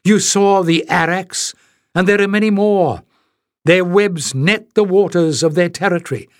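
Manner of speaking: lightly voiced guardian, warning the travellers; narrator's light Penn voice, urging caution; Penn's warning. A narrator's reading.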